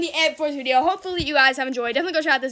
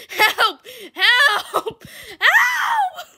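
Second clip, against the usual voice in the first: calls building to a high shout; playful panic, yelling for help; mock cries for help; 8 semitones higher, 3 dB louder, much slower than usual. Stuck in dryer, calling out.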